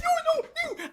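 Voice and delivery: high, hooted vowels; mock high deaf voice; imitated deaf woman. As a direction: mimic a deaf arguer's blurred speech.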